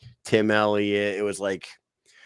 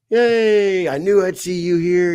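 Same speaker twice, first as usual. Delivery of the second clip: high, loud, thrilled hello; excited greeting; 11 semitones higher, 8 dB louder than usual. Retold or imitated. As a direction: gush at an old friend. Leonard's excited greeting retold.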